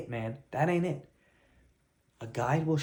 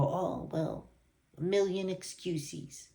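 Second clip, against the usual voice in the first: slow, soft, whiny mimic; mocking excuse voice; 5 semitones higher, 4 dB quieter, much slower than usual. Mimicking a client making excuses, slow and whiny. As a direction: drag out the excuses like a sulky client. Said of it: "you're gonna go".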